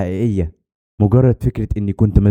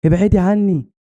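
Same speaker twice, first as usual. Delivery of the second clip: mild narrated scream; 9 semitones higher, slower than usual. A narrator's reading. Buried girl to a cold touching hand, mild scream. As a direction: recoil with a raised, frightened cry. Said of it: "وصرخت".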